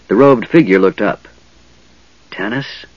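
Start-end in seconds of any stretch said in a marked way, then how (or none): 2.3-2.9 a soft, hushed question of the name; whispered. A narrator's reading. Mage greeting an old friend, in a hushed whisper. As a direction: barely breathe the name out. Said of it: "the man whispered".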